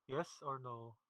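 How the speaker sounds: ordinary talk, nothing marked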